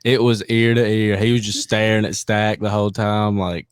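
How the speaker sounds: ordinary talk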